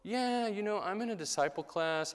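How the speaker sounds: high, loud mock breezy reply; imitating an eager class-goer; self-satisfied churchgoer. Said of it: "when I hear people go".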